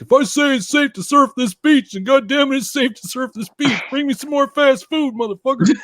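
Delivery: mock commander demanding fast food; Robert Duvall impression. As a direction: bark it like a mad war commander. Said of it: "like fucking Robert Duvall".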